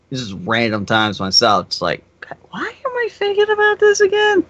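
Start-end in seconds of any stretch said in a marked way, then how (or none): 2.5-4.4 high squeaky exasperated question; exasperated falsetto. Retold or imitated. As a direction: whine it in a high voice. Exasperated inner thought voiced.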